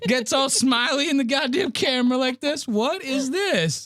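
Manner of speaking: very high-pitched disbelief, slowing at the end; exasperated rant. Real, not acted. Host mocking the smiley footage, exasperated. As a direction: rant upward, then drag out the question.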